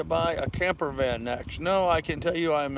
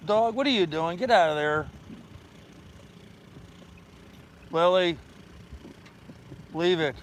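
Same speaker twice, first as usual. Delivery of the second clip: scolding the dog; 4 semitones higher than usual. Real, not acted. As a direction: snap at the dog to stop. Scolding dog getting into things.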